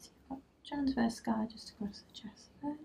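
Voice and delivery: whispered note to self; whispering. Real, not acted. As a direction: breathe the finding out softly. Noting findings under breath.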